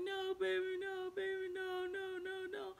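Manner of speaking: high whiny mock sobbing pleas; imitating crying man. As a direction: wail like a caught cheater begging. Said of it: "like, crying".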